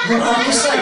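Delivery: ordinary delivery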